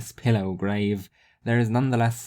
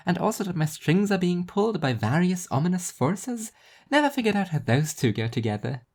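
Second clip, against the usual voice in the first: higher, dry self-mocking voice; Rhea, wry and higher; 5 semitones higher than usual. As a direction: shrug off the hate with dry humour. Rhea jokes about media.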